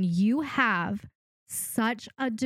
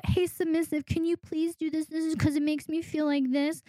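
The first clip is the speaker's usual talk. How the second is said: higher, meek pleading request; pandering; 7 semitones higher than usual. Retold or imitated. Coach imitates a dominant pandering to her submissive, meek and high. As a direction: ask timidly as if pandering. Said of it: "You are pandering to them".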